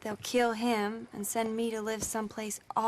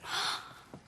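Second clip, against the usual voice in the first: delighted gasp; 6 dB quieter than usual. Acted. Penelope hears it is from Bo.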